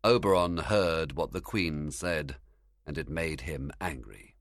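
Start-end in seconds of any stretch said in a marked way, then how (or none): none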